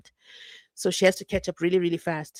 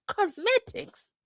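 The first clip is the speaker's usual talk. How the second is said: high, slow disbelieving cosmetics; can't believe she said cosmetics; stunned questioning echo; incredulous echo; 14 semitones higher, slower than usual. Real, not acted.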